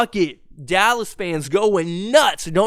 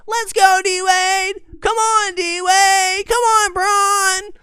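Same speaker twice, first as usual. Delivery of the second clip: put-on squeaky, high-pitched teenage fan cheering; prepubescent boy cheer; 13 semitones higher, 4 dB louder, much slower than usual. Sixteen-year-old cheering on his team, high boyish squeal. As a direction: cheer way up high like an unbroken-voiced kid. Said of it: "My balls hadn't dropped yet".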